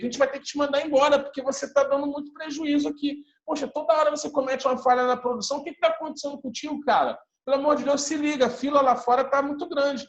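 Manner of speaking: bronca rápida, mais aguda e alta; dá uma bronca grosseira no funcionário, rápido e alto; chefe rude e arrogante. Shown as a demonstration. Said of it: "eu fui um chefe bem rude, bem arrogante". (chefe repreendendo o João na sala, rude, agudo e acelerado)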